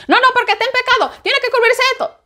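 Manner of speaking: high, quick scolding objection; mock accuser. (imitando una acusación, voz aguda y rápida)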